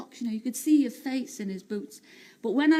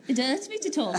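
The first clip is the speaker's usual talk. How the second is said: lightly put-on posh accent; a hint of strained, mock-posh accent; 3 semitones higher than usual. Shown as a demonstration. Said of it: "You do your posh accent then".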